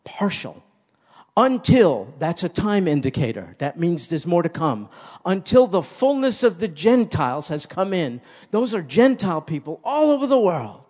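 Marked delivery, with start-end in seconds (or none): 1.4-2.1 Until thrown out high and loud; preacher hammering the key word; loud emphasis. Acted. 5.2-10.8 loud, lifted pulpit voice; preacher proclaiming to the flock; fervent preaching. Acted. Preaching at full force.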